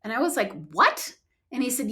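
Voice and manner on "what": high-pitched startled question; startled disbelief. Retold or imitated. Retelling her shock at the broken foot news, startled. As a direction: blurt it out in retold surprise.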